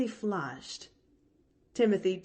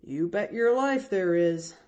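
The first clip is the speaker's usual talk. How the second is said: lightly voiced hearty man, a little louder; cheerful driver cutting in; coachman Timothy; 4 dB louder than usual. Coachman reassuring the girl, lightly voiced man.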